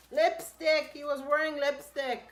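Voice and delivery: loud, high, laughing disbelief; amused exclamation. Real, not acted. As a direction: blurt it out, still laughing. Laughing at the husband's lipstick.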